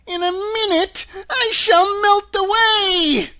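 high, wailing, full-force shriek; panicked witch shrieking and crying out; witch's scream. A narrator's reading. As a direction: scream slowly as if melting away. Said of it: "she screamed".

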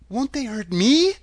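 lightly voiced worried child; anxious girl. A narrator's reading. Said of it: "asked the girl anxiously".

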